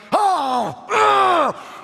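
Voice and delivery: high, loud hammed-up cry of rage; mock angry outburst. A cartoon God catching you cussing, mock furious shout. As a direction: burst out like a petty god losing it. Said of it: "and he's like".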